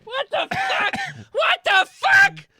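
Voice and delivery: high, loud freaked-out passengers; panicked crowd. Retold or imitated. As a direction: shriek it like a startled crowd. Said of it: "everyone's like".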